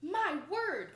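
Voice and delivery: high, loud amazed exclamation; marveling. Acted. Annette marveling at the heavy bathing clothes, amazed. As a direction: gasp it out in wonder. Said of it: "marveled Annette".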